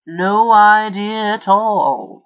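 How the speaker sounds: slow, deadpan "No idea at all"; flatly. A narrator's reading. Jake to the witnesses, slow and deadpan. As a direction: drag it out, unimpressed. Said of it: "Jake said flatly".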